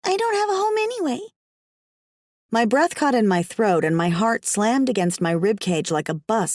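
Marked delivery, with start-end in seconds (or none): 0.0-1.2 put-on high child voice, a bit weary; little girl Alice. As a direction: speak as a young girl telling her story.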